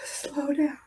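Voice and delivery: soft pleading whisper. Acted. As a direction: let it fall to a whisper. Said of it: "if you would just slow down".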